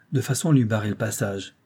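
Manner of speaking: ordinary delivery, nothing marked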